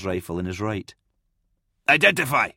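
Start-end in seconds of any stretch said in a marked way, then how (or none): 1.9-2.5 loud, higher sharp command; yelled challenge. A narrator's reading. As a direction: challenge approaching figures with a shout. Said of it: "he yelled".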